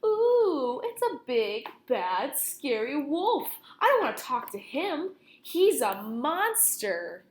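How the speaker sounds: high, slow mock-frightened voice; wolf mimicking the reader's fear, slow and mocking; mocking scared reader. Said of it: "You're thinking".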